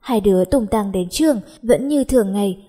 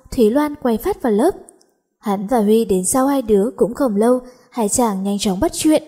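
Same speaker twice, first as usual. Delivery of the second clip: usual speaking voice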